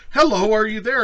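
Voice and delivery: high, loud eager hello; hammed-up high, loud woman's voice; mock woman typing. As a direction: chirp it like a hopeful chatter. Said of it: "she'd be like".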